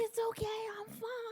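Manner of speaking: high, strained, softening to a whisper; strained fake calm. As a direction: pretend you're fine through the pain. Pretending to be fine in pain.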